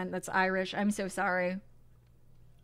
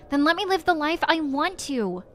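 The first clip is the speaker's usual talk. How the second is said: raised, higher-pitched protest; defiant outburst; 6 semitones higher, 4 dB louder than usual. Acted. Grown child pleading with Ma for their own life, defiant. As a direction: push back at a parent, voice climbing.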